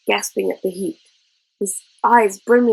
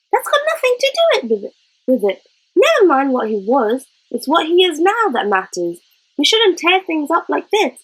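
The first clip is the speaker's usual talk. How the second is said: high, hurried, exasperated scolding; upset cry; 7 semitones higher than usual. Acted. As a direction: rush it out, higher, half in tears of frustration. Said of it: "she cried".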